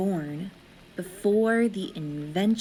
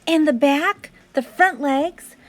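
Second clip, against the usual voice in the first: put-on child drawing on the wall, eager; eager showing; 6 semitones higher, 4 dB louder than usual. A narrator's reading. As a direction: show each part with care.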